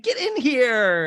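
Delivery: mock welcoming cry. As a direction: pull her in with a big hammed-up welcome. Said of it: "And Heather's like".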